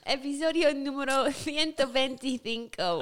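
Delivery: playful Spanish pronunciation through laughter; Spanish accent. Shown as a demonstration. Co-host answering in actual Spanish, playful Spanish accent. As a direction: announce it in a put-on Castilian Spanish accent. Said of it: "actual Spanish".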